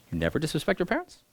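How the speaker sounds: ordinary talk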